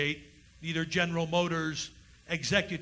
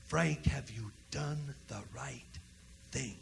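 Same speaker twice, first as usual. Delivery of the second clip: much softer, slow whisper in the ear; ghostly whisper; 3 semitones lower, 9 dB quieter than usual. Retold or imitated. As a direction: breathe it like a grandfather's ghost. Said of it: "whisper in your ear".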